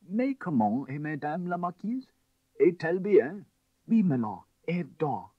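anxious French maid. A narrator's reading. French maid answering lord.

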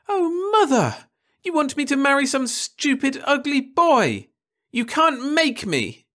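very high, loud indignant whine; outraged girl. A narrator's reading. Daughter outraged at mother's marriage plan, very high and loud. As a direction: recoil in disgust at the idea.

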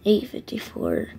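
hoarse near-whisper; breathy, voice almost gone; raspy whisper. Real, not acted. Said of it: "that's why I have low voice".

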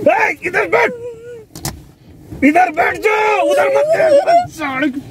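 high, rising yells, drawn out at the end; angry shouted orders. Acted. Driver stopping passenger looking for escape, angry shouting.

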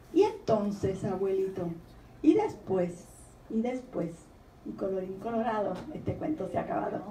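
quiet, fading fairy-tale ending; softly closing a story. Acted. Author ending the children's poem softly. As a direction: let the tale end in a hush.

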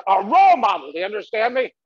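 loud scolding father; a hint of a drunk dad scolding. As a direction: role model, do you understand me, thrown back at the son. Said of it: "My father was always saying".

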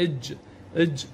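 "اج" closed on a vowelless j; sukun, no vowel. Shown as a demonstration. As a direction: end each "اج" on the j, no vowel after. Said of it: "بدون حركات ساكن".

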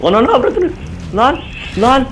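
hammed-up madman outburst; wild unhinged ranting. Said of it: "deli taklidi yaparsam". (abartılı deli taklidi)

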